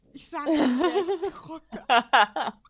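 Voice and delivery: loud, slow, laughing emphasis; can't get it out for laughing; gleeful loud laugh-talk; laughing delight. Real, not acted. Laughing enthusiasm about a scene.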